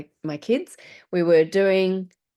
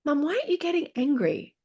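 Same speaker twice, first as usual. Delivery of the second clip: put-on child's voice; 9 semitones higher, faster than usual. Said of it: "my youngest looks at me and goes".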